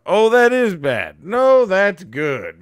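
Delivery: hammy singsong routine; vaudeville patter. Retold or imitated. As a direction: swing between the two like an old stage act. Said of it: "it's like that oldest vaudeville".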